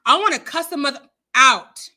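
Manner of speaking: outraged, emphatic cursing, punched word by word; loud, angry rant. Real, not acted. Host cursing out a man who disgusts her, loud and angry. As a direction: let the disgust burst out loudly. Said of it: "if I get too loud".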